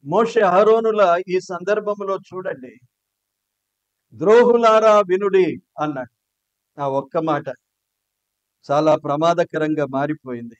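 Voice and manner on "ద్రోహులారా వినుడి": loud, furious rebuke to the crowd; angry shout. Retold or imitated. Moses shouting at the whole assembly in anger. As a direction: bellow it at the rebels. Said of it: "ఆ కోపములో ఎంత గట్టిగా మాట్లాడుండొచ్చు".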